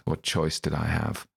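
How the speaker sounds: ordinary delivery, nothing marked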